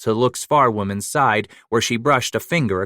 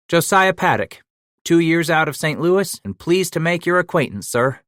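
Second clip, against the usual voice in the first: put-on higher, polite young frontiersman; a keen youngster minding his manners; eager young man voice; 8 semitones higher, 3 dB louder than usual. Young man introduces himself.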